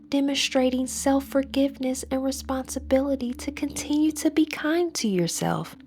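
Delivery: usual speaking voice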